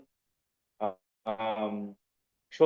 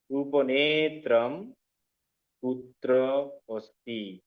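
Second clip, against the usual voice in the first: slow word-by-word read; much slower than usual. Shown as a demonstration. Teacher reads the Sanskrit sentence to students, word by word. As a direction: lay each Sanskrit word down slowly. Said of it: "চশমাটি কোথায়?".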